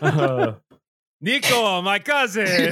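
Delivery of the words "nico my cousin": high, hammed-up Russian accent. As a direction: play a goofy Eastern European cousin with a Russian accent. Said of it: "doing bad Russian accent".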